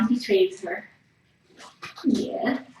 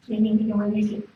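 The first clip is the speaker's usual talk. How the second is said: ordinary delivery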